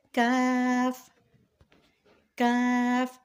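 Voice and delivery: کاف with light k and open "aa"; thin k. Shown as a demonstration. Teacher modelling for learners, کاف thin. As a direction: keep کاف thin and held, lips unrounded. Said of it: "اس کو پڑھا جاتا ہے باریک".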